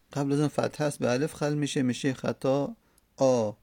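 ordinary delivery

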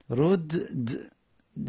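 رد د dragged out very slowly, both dals held apart; slow demo. Shown as a demonstration. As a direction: pronounce رد د slowly so the two dals are heard.

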